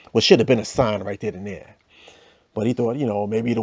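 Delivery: normal talking voice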